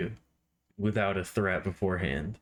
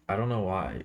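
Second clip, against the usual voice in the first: normal talking voice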